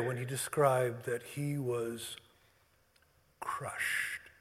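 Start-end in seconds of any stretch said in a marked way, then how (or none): 3.4-4.3 whispering. Acted. Solemn scripture recall.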